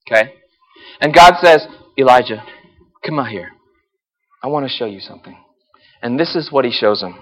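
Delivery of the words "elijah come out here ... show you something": gentle God voice. Acted. God gently calls Elijah out.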